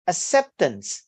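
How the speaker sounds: "acceptance" stressed on CEP, falling after; second-syllable stress. Shown as a demonstration. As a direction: lean on the middle syllable of "acceptance". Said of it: "primary stress on the second syllable".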